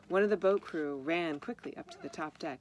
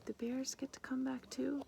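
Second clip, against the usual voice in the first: high, whispered tiny voice; little bear voice; 9 semitones higher than usual. Acted. The bear's imagined little voice speaking to the mother, a high whisper. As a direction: breathe it like a small toy bear. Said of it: "Rainbow Sweetie's little voice".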